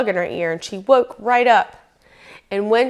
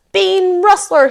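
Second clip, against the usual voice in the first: loud, high angry bear roar; 11 semitones higher, 8 dB louder, slower than usual. A narrator's reading. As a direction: roar the accusation as an upset grizzly. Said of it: "he roared".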